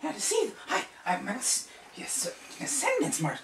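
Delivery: very high, loud, squeaky wizard voice; excited old wizard. Acted. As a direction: gush like a frantic little sorcerer. Saul examining the marks.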